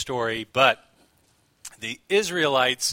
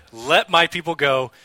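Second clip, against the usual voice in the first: louder, firm command at a brisker pace; loud proclamation; 3 semitones higher, 8 dB louder than usual. Acted. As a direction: call it out as an order. Repeats line after his impression.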